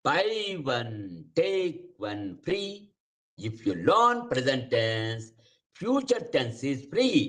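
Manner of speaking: drawn-out teacher's catchphrase; slow slogan delivery. Shown as a demonstration. Teacher repeating his slogan to students, slowly. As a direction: stretch out each word of the slogan. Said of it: "So I used to tell them".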